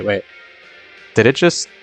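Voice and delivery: normal talking voice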